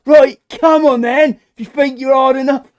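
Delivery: squaring up like a cocky chav; chavvy mod. Retold or imitated. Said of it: "like a, a chavvy mod, goes".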